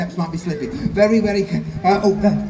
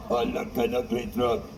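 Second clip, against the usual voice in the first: low princess voice; 12 semitones lower, 10 dB quieter than usual. Acted. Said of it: "Can you talk higher?".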